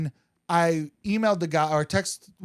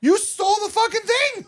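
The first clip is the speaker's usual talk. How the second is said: higher, exasperated outrage; indignant; 13 semitones higher than usual. Retold or imitated. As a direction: call out the thief. Ranting about stolen meme.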